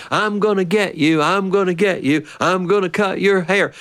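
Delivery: a hint of playful chasing father; teasing dad. Dad chasing little brother with clippers, playful taunt.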